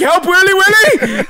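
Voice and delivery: high-pitched mock panicked plea; imitated high wailing cry; retold yell for help. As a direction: call for help as if dangling. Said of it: "he starts yelling".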